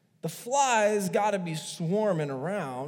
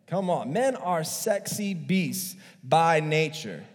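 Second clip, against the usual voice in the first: ordinary delivery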